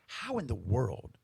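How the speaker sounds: ordinary delivery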